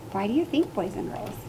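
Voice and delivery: usual speaking voice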